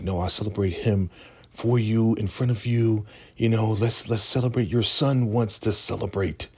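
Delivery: high-pitched imitated woman's voice; mock placating flattery. Ruth soothing the Shogun, high flattering mock voice. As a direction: lift the voice high and butter him up.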